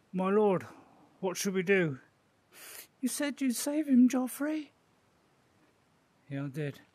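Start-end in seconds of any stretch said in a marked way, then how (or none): 0.1-2.0 raised, urgent lord's servant; loud pleading courtier. Acted. VR Game of Thrones, voicing character. 3.0-4.7 much higher desperate plea; high pleading voice. Acted. Voicing pleading character.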